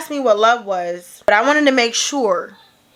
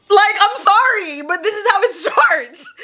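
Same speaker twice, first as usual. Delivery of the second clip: incredulous, pitched up and laughing; laughing apology; 8 semitones higher than usual. Real, not acted. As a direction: talk through rising laughter. Cracks up while making her point.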